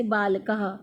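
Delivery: बालकः ending in an audible "ha"; visarga demostrada. Shown as a demonstration. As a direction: sound out the final ः of बालकः as a breath of h plus a. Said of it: "ह की आवाज़ देता है".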